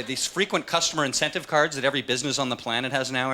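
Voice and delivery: ordinary delivery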